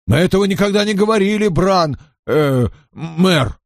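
high, rapid backpedalling; hurried denial. A narrator's reading. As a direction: rush it out, then stumble over the title. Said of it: "быстро сказал Дарл". (торопливое оправдание высоким голосом)

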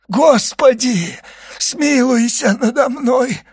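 slow, high wailing plea; anguished prayer. A narrator's reading. Dying servant begs God for mercy, wailing. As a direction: stretch out a desperate cry for mercy.

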